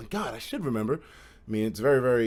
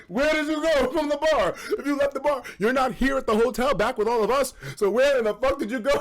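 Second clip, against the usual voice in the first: asking through laughter; half-laughing question; 7 semitones higher than usual. Real, not acted. Laughing while quoting his text to friends, laughing.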